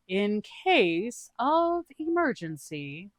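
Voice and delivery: high, very slow, overly innocent; a bad liar stalling; Doog's voice. Doog lies to Ao, high and drawn out.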